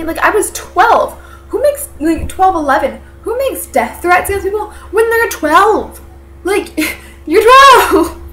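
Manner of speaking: incredulous outburst building to a loud, high peak. Real, not acted. Telling how a child made death threats, incredulous. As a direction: exclaim it high in disbelief, stretching the key words.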